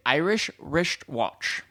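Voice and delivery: slow Scottish accent with slushy s; demonstrating a Scottish film-star accent; Sean Connery. Shown as a demonstration. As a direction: draw out wristwatch as "rishtwatch", Scottish. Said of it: "a Sean Connery accent, just say".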